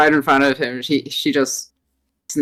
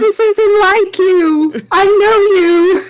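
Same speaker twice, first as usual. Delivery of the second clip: pleading character voice; 3 dB louder, slower than usual. Acted.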